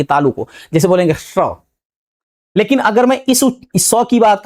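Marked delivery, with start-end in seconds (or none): 1.2-1.3 स as a hushing "sh", tongue touching the palate; palatal sibilant. Shown as a demonstration. Teacher showing students the palate sound, palatal "sh" on स. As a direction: draw out a palatal "sh" for स. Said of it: "हमारी जिह्वा किसको touch करेगी".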